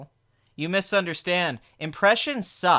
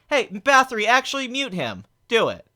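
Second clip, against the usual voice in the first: raised, forceful command; calling out orders; 5 semitones higher, 4 dB louder than usual. Pretend. Streamer ordering Bathory to mute a chatter, raised commanding voice. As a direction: call to the moderator, louder and higher at the start.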